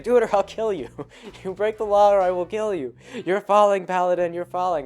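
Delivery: threatening mock menace. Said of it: "she's like".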